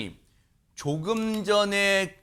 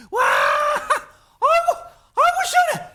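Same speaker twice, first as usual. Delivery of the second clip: high, loud cry of relief; hammed-up shout of catharsis; gleeful venting shout; 6 dB louder, slower than usual. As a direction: whoop as if all stress bursts out. Imagines wife venting online.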